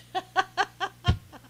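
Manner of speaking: high, loud burst of laughter; real, helpless laughing fit. Real, not acted. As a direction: crack up in staccato peals. Laughs at own hint.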